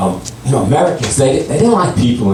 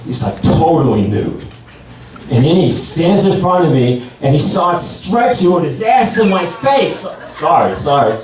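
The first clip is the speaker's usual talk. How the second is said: normal talking voice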